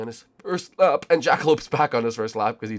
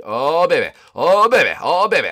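excited cheer; 6 semitones higher, 8 dB louder than usual. Real, not acted. Celebrating closing in.

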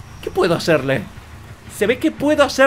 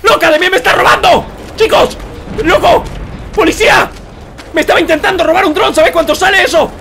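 indignant shouting; 8 semitones higher than usual. Real, not acted. Streamer yelling at a player stealing his drone, indignant shouting. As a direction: call out in outraged alarm, pitch way up.

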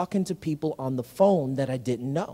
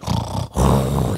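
long low contented sigh; deep relaxed exhale, feet up on the desk; lazy sigh. Shown as a demonstration. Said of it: "you know, like that".

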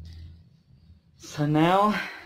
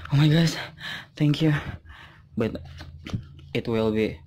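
normal talking voice

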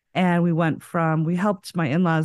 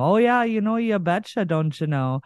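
exaggerated Minnesota folksy lilt; mock Minnesota accent; 2 semitones higher than usual. Shown as a demonstration. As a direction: send up your own Minnesota accent. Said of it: "I'll make fun of it".

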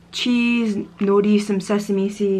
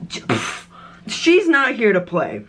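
usual speaking voice

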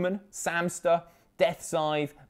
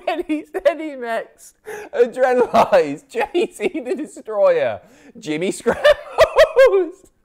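giggling through names, voice pitched up; fighting back laughter while reading out names; laughing fit; 10 semitones higher, 4 dB louder, slower than usual. Real, not acted. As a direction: streamer cracking up over a donor name. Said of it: "That caught me off guard".

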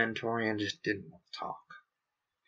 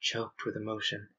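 usual speaking voice

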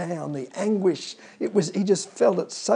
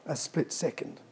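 ordinary talk

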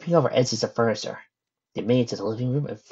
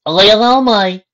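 loud, whooping southern voice; southern boy; 11 semitones higher, 13 dB louder than usual. A narrator's reading. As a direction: crow it in a southern accent. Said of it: "the southern boy conferred with a cackle".

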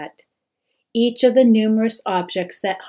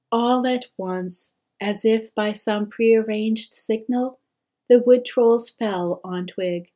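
ordinary delivery

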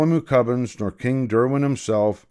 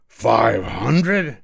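louder, amazed question; exclaimed; 4 dB louder than usual. Acted. King amazed at the count, louder question. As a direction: marvel at the number, louder. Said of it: "exclaimed the king".

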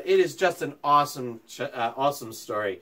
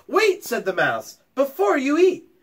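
high, loud squeaky mouse call; mouse crying wait; 10 semitones higher, 4 dB louder than usual. Acted. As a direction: halt her with a sudden high cry. Mouse stops the Gruffalo's child.

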